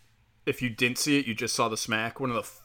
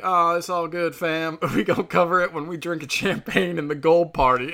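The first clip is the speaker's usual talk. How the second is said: higher, louder hype-man voice, laughing through it; breezy party-host brush-off, pitched up; 5 semitones higher, 4 dB louder than usual. Retold or imitated. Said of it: "did the most [name] thing ever".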